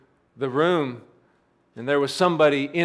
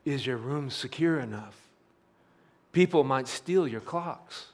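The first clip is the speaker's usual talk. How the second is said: hushed, menacing murmur, much softer; sinister whisper; 2 semitones lower, 9 dB quieter than usual. Acted. Whisper makes collector fear thieves, sinister hush. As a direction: plant fear quietly in his ear. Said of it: "the whispering even got sinister".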